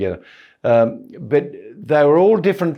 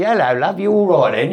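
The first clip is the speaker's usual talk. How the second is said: imitating mum's English accent, higher pitched; 7 semitones higher, 3 dB louder than usual. Son imitating his mum greeting him, English accent. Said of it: "My mum talked like that".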